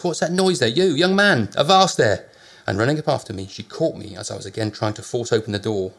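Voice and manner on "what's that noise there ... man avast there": landlady calling out. A narrator's reading. Landlady shouting at narrator.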